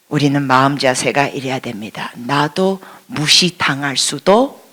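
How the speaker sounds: ordinary delivery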